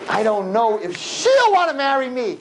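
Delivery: high, slow whiny doubt; mock whiny groom; whiny doubting boy. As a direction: whine it like the unsure groom. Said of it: "what would we say?".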